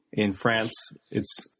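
usual speaking voice

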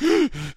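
sharp high gasp like a fish out of water; hammed-up death gasp; mock dying gasp. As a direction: gasp loudly as a flopping dying wizard. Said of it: "and he's like".

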